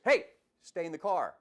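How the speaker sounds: a lightly voiced order from an officer; firm officer command. Officer telling a driver who jumped out to stay put, firm command. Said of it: "[name] using his tone of voice".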